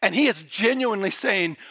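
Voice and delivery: ordinary delivery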